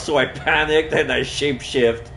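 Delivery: high, loud mocking parody; mocking sing-song. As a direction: parrot her excuse back in a silly voice. Mocking her own excuse.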